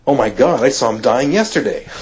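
loud, astonished eureka cry; excited realization. Retold or imitated. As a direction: blurt it out as the penny drops. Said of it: "I'm like".

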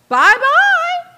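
high, loud, sing-song bye-bye; mock dismissal. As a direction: wave her off in a high, loud sendoff. Imagined boss dismissing employee.

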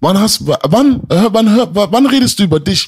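loud and high, voice rising in real anger; real angry yelling at a fellow rapper; furious outburst. Real, not acted. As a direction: shout the accusation at him, stumbling over the words. Heated argument, demanding answers.

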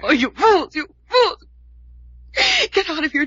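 high, loud desperate shout; frantic shout of fools; frantic cry. Acted. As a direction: yell at them to get out. Frantic warning to leave.

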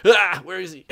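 loud, high panicked yell; mock panic. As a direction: blurt it out like a flustered rookie. Retold noob's panic at leader's order.

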